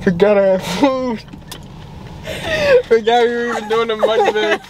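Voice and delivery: giggling through the words; laughing. Real, not acted. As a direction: laugh while admitting it. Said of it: "What are you eating?".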